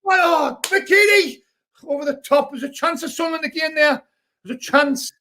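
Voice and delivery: rising, shouting play-by-play as the chance builds; excited live commentary. Acted. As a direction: call the attack like it's happening now.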